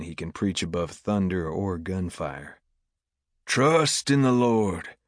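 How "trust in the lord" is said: loud, slow, high-pitched proclamation; preacher's shout. A narrator's reading. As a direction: call it out slowly to the rafters. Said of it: "he shouts, such that the rafters shake".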